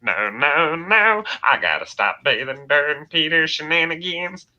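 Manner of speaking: high, loud cartoon fussing; Cleveland Brown. Shown as a demonstration. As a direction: protest in a rising cartoon voice. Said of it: "Cleveland Brown is so easy to do".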